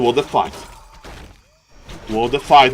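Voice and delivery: ordinary talk, nothing marked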